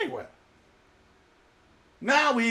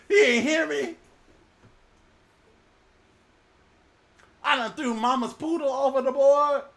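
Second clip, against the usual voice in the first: louder comic complaint; exasperated sailor; 4 semitones higher, 5 dB louder than usual. Acted. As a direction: protest with mock outrage.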